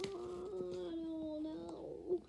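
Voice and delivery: soft dismay. Real, not acted. Reader dismayed by the story, a soft oh no. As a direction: let it slip out softly.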